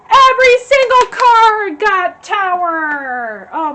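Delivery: high, loud, drawn-out exclamation; loud astonishment. Real, not acted. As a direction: stretch it out loudly in amazed disbelief. Astonished at the repeated card.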